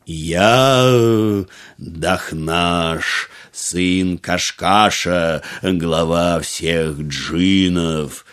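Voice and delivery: low, very slow, grand genie; booming genie voice. A narrator's reading. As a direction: announce yourself like a mighty spirit. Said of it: "ответил джин".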